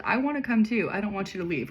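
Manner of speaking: plain reading voice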